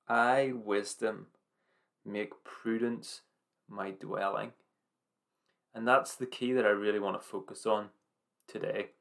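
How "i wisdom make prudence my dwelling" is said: slow, each phrase weighed; slow deliberate verse. Acted. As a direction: lay the key verse down word by word. Key verse repeated.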